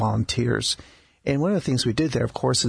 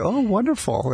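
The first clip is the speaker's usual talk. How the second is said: delighted exclamation; 10 semitones higher than usual. Retold or imitated. Doctor retells cheerful reply.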